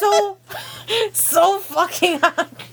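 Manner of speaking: zombie voice cracking into laughter; slow undead voice, breaking into giggles. Acted. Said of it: "That's my zombie voice for you guys".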